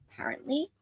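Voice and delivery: breathy whispered aside. Real, not acted. Host whispering an aside to her co-host, hushed. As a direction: drop to a secretive whisper.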